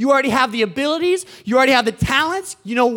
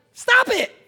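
high, raised exclamation; sharp command; 12 semitones higher than usual. Real, not acted. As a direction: order them to quit, pitched way up. Preacher ordering the congregation.

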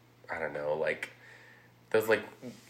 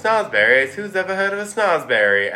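higher, louder incredulous sneer; scoffing girl; 8 semitones higher, 5 dB louder than usual. Retold or imitated. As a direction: sneer like a bratty girl who can't believe it. Veruca scoffing at Wonka.

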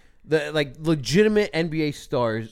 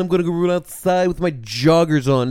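mocking jogger; 3 semitones higher, 6 dB louder than usual. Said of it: "It's like".

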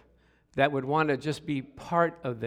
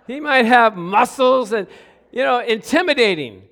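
louder, higher, laughing through it; excited storytelling; 6 semitones higher, 9 dB louder than usual. Acted. Preacher picturing a nervous young suitor, excited and laughing. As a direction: paint the nervous scene with gusto.

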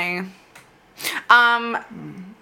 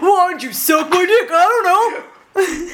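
higher and louder mock douchebag shout; hammed-up douchey guy, higher and louder; 9 semitones higher, 4 dB louder, slower than usual. Host goading the ghost, higher, louder douchey guy voice. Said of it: "douchey guy voices".

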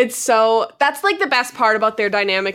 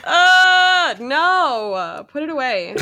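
exaggerated cringe; 4 semitones higher, much slower than usual. Real, not acted. Podcaster cringing at cheesy dialogue, exaggerated cringe. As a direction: groan in disgust, then let it drop.

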